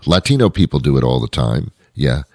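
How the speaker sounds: ordinary delivery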